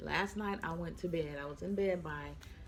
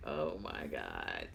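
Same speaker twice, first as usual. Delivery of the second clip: mock overwhelmed; 3 dB quieter, slower than usual. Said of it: "I'm just like".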